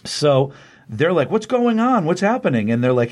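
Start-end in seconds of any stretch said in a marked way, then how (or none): 1.3-2.7 high-pitched imitation of confused turtles; mock bewilderment. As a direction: act out startled confusion, pitch jumping up. Turtles' bewilderment on arrival, retold.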